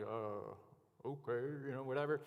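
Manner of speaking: badly faked Southern accent; a rough stab at a Southern drawl; half-hearted imitation of a deep Southern accent. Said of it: "had this deep Southern, actually, I can't do accents".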